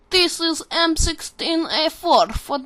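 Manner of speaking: slow, high, loud mock Russian accent. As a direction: ham up a drawn-out Russian accent. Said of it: "talk in a Russian accent".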